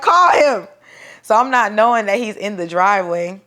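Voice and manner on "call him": urgent demand. Retold or imitated.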